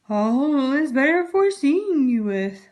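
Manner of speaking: slow, lightly wobbly fake grandma; wolf faking a frail old lady; strange wobbly voice. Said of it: "in the same wobbly voice".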